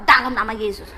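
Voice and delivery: low, loud rebuke of the devil; acted-out stern exorcising command; retold rebuke. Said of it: "Langsung saya tengking itu suara".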